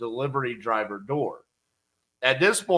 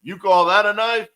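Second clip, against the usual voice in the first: bad Australian accent; 5 semitones higher, 4 dB louder than usual. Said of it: "I have no Australian accent".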